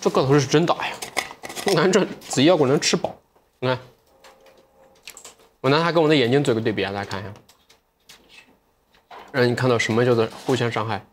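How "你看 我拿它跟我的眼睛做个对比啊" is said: ordinary talk, nothing marked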